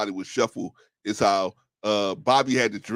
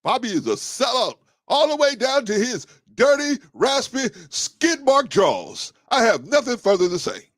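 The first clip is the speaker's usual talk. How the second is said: much higher, pushed-up granddad rant; John Witherspoon impression; 11 semitones higher, 3 dB louder than usual. Quoting the granddad ranting about sellout Bobby in a high voice. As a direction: play the outraged old granddad from The Boondocks. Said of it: "John Witherspoon".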